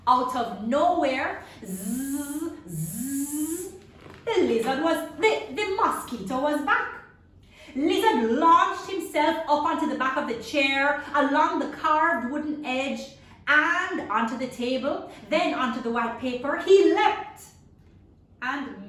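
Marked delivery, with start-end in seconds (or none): 1.7-3.7 mosquito buzz. Acted. 16.7-17.4 higher, much louder burst; big excited leap; dramatic leap. A narrator's reading.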